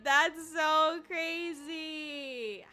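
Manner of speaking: slow, high, drawn-out amazement; amazed disbelief. Real, not acted. Streamer reeling from a follower's prank account, drawn-out amazement. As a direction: stretch the words out in disbelief.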